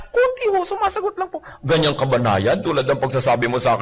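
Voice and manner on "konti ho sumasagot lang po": coy wife. Acted. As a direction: play innocent in a high voice. Wife downplays talking back.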